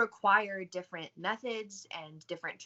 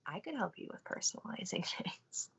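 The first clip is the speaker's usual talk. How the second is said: sly whispered aside; whispering; 6 dB quieter than usual. Real, not acted. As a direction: whisper the offer with a laugh. Sly whispered self-plug.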